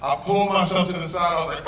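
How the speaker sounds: ordinary talk, nothing marked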